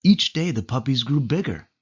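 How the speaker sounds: plain reading voice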